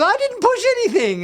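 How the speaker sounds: high-pitched imitation of a little squeaky girl. Fan imitating Rok-Tark's first translated words, squeaky little-girl voice. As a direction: put on a tiny, squeaky girl's voice. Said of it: "with this little squeaky voice from a little girl".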